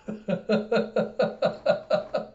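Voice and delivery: high, repeated giggles; long helpless laughing fit; real laughter. Real, not acted. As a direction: keep cracking up. Host keeps laughing.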